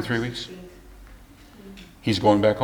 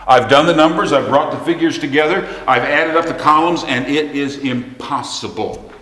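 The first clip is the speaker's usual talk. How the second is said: raised, higher-pitched preacher's declaration; emphatic preaching; 5 semitones higher, 3 dB louder than usual. Acted. Preacher voicing a believer facing money trouble, emphatic and raised. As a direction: preach it loud and high, slowing on the last word.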